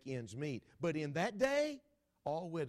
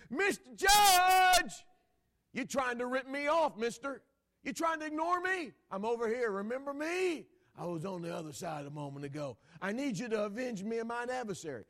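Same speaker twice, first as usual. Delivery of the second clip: hollering widow; 8 semitones higher, 8 dB louder than usual. Retold or imitated. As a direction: scream for the judge's attention. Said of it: "she hollers".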